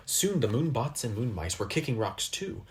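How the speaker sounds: ordinary delivery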